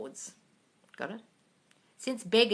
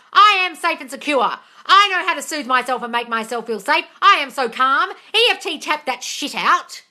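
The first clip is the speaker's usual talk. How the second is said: loud, higher tough-love rant; louder tough-love telling-off; yelling advice; 6 semitones higher, 7 dB louder than usual. Real, not acted. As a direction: bark instructions. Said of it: "I'm yelling at you".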